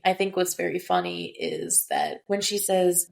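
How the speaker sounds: ordinary delivery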